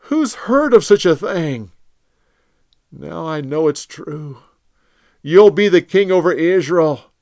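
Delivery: a touch of crying in a loud plea; broken king begging mercy; tearful. Acted. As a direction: Saul weeping as he begs David. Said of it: "he broke down and cried".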